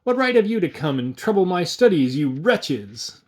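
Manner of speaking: a little hermit scolding intruders; shouting. Acted. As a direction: shout it in grand offended outrage. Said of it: "came out shouting".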